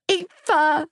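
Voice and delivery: put-on high little-boy voice; sniffling small child. A narrator's reading. Small boy clinging to her, whimpering her name. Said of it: "Sniffling, he burrowed his face in my neck".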